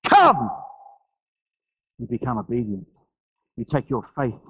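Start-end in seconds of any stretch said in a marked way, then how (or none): 0.0-0.5 high, loud single-word call; commanding call. Retold or imitated. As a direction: summon across the storm. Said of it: "when you hear that voice".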